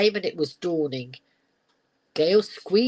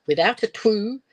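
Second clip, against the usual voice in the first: usual speaking voice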